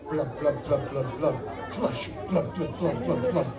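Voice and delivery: bubbly blub-blub sounds, lips popping; fish noises. Pretend. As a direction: play a gurgling fish for the kids. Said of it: "let's all make fish noises".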